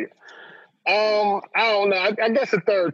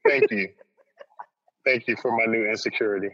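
mock sarcastic thanks; 7 semitones lower than usual. Real, not acted. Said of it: "swallow insecurity".

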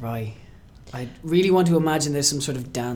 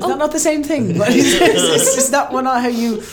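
higher and louder, laughing through it; laughing protest; 10 semitones higher, 9 dB louder than usual. Real, not acted. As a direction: protest while cracking up. Teased about courting, laughing back.

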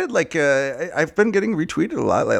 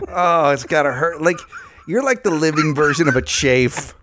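ordinary talk

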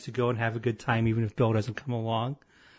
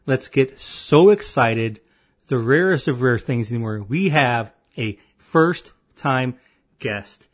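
announcer voice; 6 dB louder than usual. Acted. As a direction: boom it out like a game show host. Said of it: "Oh, that was fantastic".

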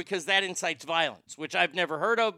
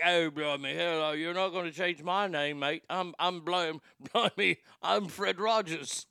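hammed-up Cockney "blimey" voice; mock British accent. [name] refuses the Beatles' name change, in a mock British accent. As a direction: play a stubborn Englishman refusing to change his name. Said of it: "[name]'s like".

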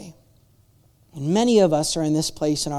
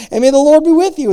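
proclaimed blessing; 9 semitones higher, 11 dB louder than usual. Acted. Blessing read loud.

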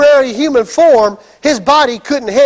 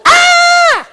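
high, loud mock scream of fright; terrified scream; 4 dB louder than usual. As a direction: shriek like scared disciples. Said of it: "Bunch of disciples".

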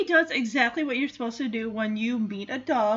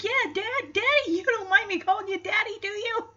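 high-pitched, eager mock pleading; butter up Dad in a high, playful put-on voice; wheedling dog; 10 semitones higher than usual. [name] asks Dad to let Delilah stay, playful high wheedling.

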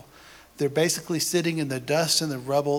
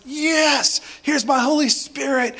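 much higher, louder, exultant proclaiming; joyful God; 9 semitones higher, 4 dB louder than usual. Pretend. God welcoming repentant people, high loud exultant proclamation. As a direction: burst out with God's eager welcome.

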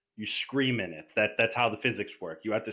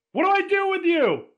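very high, loud cry; high-pitched comic wail; talking body part; 6 dB louder than usual. Acted. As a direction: call out in a high put-on voice. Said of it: "what I would whisper back".

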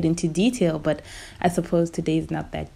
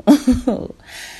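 bright laugh; 7 semitones higher, 4 dB louder than usual. Real, not acted. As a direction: burst of high laughter. Laughing after her hello.

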